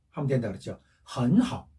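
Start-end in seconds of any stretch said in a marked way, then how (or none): 1.2-1.7 헌하오 with a rising second tone on 헌; 앞 삼성을 이성으로. Shown as a demonstration. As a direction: lift 헌 in 헌하오, then dip low on 하오. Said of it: "앞에를 이성으로 읽는다 그랬죠". (헌하오의 앞 음절을 이성으로 올려서)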